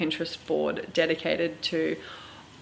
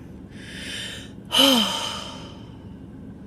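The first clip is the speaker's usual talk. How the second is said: heavy sigh; 7 semitones higher than usual. Real, not acted. Sigh of anxiety.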